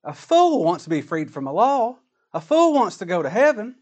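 ordinary delivery

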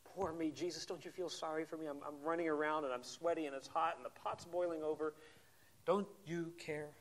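softly whined self-pity. Retold or imitated. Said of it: "You hear some self-pity in there".

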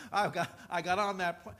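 normal talking voice

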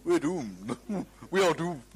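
mock-gloomy English voice; understated English version of the doom cry, with a chuckle; English accent. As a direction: imitate an English doomsayer. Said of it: "might be English people saying".

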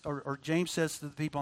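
ordinary delivery

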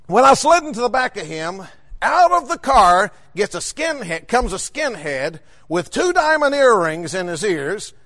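ordinary delivery

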